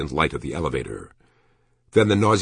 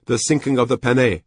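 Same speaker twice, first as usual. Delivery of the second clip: high, earnest voice of a Japanese officer; Japanese collector; 8 semitones higher, 4 dB louder than usual. A narrator's reading. Telling the shopkeeper of a card he lacks, high-pitched character voice. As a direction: speak as the Japanese major, pitched up.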